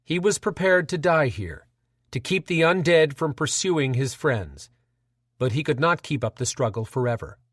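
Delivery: usual speaking voice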